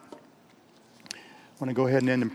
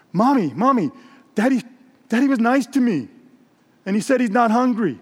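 acted eager kid voice, pitched up; excited little daughter; 6 semitones higher than usual. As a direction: call to mom like a thrilled child. Excited daughter reports to mother.

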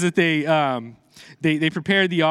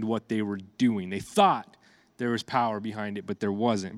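softer and lower, slowing on the point; hushed and sombre; 6 semitones lower, 6 dB quieter than usual. Real, not acted. Preacher quietly stressing false gods have no power. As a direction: let it sink in quietly over the congregation.

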